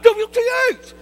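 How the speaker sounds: high, loud exasperated "W T H"; mock venting exclamation. Preacher mimicking someone venting, exasperated. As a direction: blurt it out like an annoyed texter. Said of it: "You like".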